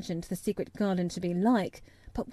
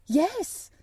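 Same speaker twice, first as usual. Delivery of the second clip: eager; 9 semitones higher than usual. A narrator's reading. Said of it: "he almost whispered his answer".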